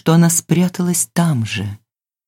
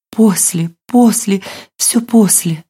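anxious urgency; 4 semitones higher, slower than usual. A narrator's reading. Hunted girl puts off questions.

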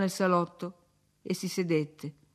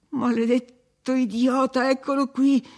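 raised, higher exasperated cry; shouted curse; 5 semitones higher, 4 dB louder than usual. A narrator's reading. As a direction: cry out in alarm. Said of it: "gridò Heathcliff".

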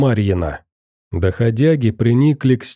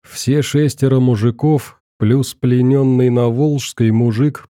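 ordinary delivery

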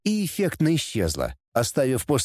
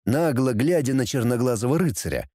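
ordinary delivery

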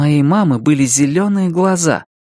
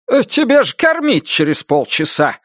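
a hint of a fussing father-in-law; 4 semitones higher than usual. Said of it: "спохватился свёкор".

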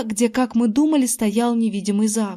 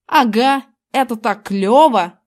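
thrilled, louder, drawing the words out; excited exclamation; 5 dB louder, slower than usual. A narrator's reading. Invisible Zack tells his friends how cool it is, excited exclamation. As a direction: exclaim it with slow, punchy relish. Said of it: "воскликнул он".

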